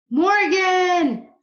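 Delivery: high, loud long call; boy calling up to the treehouse. Acted. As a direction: call her name into the dark woods. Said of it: "Jack called".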